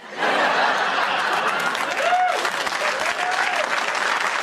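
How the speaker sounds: big laugh. Real, not acted. Loud laugh after joke.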